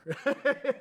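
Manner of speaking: a quick high laugh at himself; short laugh. Real, not acted. Laughing at his own progress, a quick high laugh. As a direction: laugh it off lightly.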